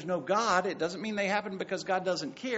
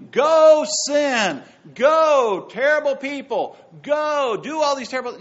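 loud, high mocking voice of a careless God; mock uncaring God; 6 semitones higher, 12 dB louder than usual. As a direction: shout it like a God cheering on sin. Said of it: "God is in heaven going".

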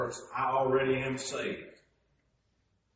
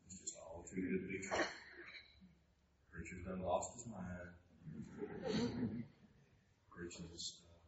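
doubting listener; 5 semitones lower, 14 dB quieter than usual. Retold or imitated. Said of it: "may be saying".

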